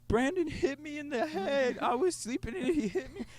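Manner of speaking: put-on high, whiny, slowed little-girl voice; tattling little sister. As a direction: whine it like a hurt kid telling on her brother. Sister tattling to dad, crying.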